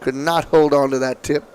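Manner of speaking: usual speaking voice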